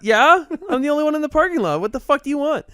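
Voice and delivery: high, loud, defiant comeback; retold confrontation. Driver answering the stranger in the parking lot, defiant. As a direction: act out firing back at a rude stranger.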